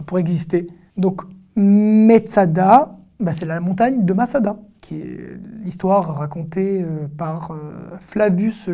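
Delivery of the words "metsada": "Metsada" in slow syllables, with a full "me"; voiced first vowel. Shown as a demonstration. Teacher showing "Metsada" with the vowel M needs, clearly sounded. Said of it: "il a besoin d'une voyelle".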